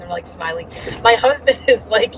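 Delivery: ordinary delivery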